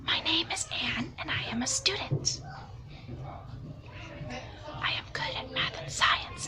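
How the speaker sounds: very quiet whispered reading. Shown as a demonstration. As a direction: breathe every word out, no voice. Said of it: "So very, very quiet whisper voice".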